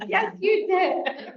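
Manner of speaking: put-on high, drawn-out cheer for a child; exaggerated high-pitched encouragement; retold gushing praise. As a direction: praise a little one in slow singsong. Grandmother retells praising her grandson.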